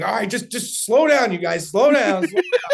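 hyped-up plea to take it easy; excited calming. Retold or imitated. Calming friends while himself worked up.

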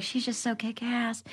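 soft admiring aside; admiring hush. Retold or imitated. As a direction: say it quietly in awe. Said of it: "I'm like".

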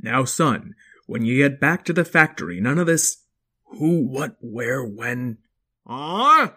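firm lecturing dad; loud dad voice. Acted. Father dinosaur voice.

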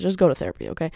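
usual speaking voice

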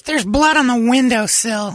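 put-on high, slow girlfriend voice, alarmed. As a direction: point it out in her high voice. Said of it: "she goes".

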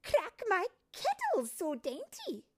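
put-on a lively old tinker boss; bossy head fairy. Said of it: "She inspected the new fairy's hands".

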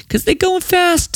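playful high voice. Pretend. Host urging listeners to buy tickets in a playful high voice. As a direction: pop up high for a mock warning.

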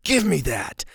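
curt, grabby order; rude demand. Shown as a demonstration. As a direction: demand it as if snatching a toy. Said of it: "that's a really rude way".

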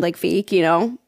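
ordinary talk

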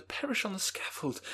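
high pleading voice, perish falling; young Elizabeth. Acted.

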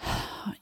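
a heavy, hammed-up teenage sigh; dramatic sigh. Teen daughter sighing at mom to manipulate her, mock dramatic sigh. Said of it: "emotional manipulation".

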